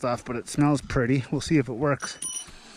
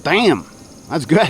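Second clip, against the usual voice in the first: delighted; 4 semitones higher, 8 dB louder, slower than usual. Real, not acted. First sip of coffee.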